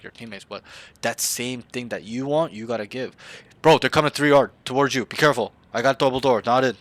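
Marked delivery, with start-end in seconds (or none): 3.6-6.8 loud urgent team callout; callout demo. Shown as a demonstration. Coach showing a player how to call out enemies, loudly urgent. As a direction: bark the warning to your team. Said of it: "That's how I would communicate".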